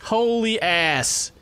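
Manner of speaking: ordinary talk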